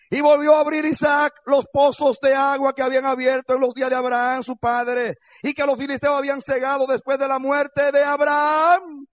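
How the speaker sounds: preaching at full force. Acted. Preacher reading Genesis to congregation, loud proclamation. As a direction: read the verse aloud like a proclamation.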